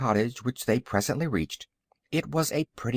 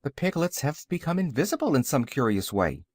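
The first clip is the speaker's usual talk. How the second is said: plain reading voice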